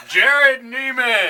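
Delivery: high, loud, stretched announcer call; fighting game announcer. Shown as a demonstration. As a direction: belt the name out slowly. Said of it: "How was that in an announcer voice?".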